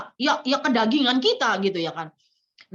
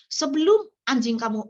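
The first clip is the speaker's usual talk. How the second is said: usual speaking voice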